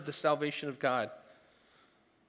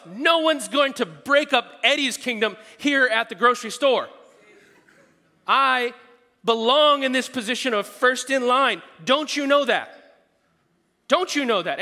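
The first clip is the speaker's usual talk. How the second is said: loud, high, indignant mock self; mock proud self; 8 semitones higher, 10 dB louder than usual. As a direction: play up his own entitled pride. Voicing his own proud thoughts.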